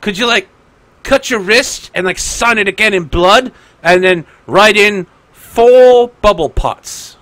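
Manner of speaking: high, loud, gushing request; eager fan. Acted. Fan guard begging a pirate for a blood signature, high and eager. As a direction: push the favor with mounting eagerness.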